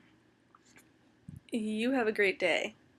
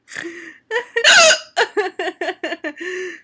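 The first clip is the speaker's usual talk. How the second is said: loud helpless laughter; helpless laughing; 7 semitones higher, 10 dB louder than usual. Real, not acted. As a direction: crack up at yourself. Laughing at own hiccups.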